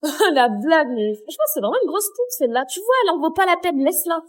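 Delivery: soft, sneering gossip aside. Acted. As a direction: murmur mean gossip to a friend. Messes basses sur une fille. (chuchoter des méchancetés à une copine)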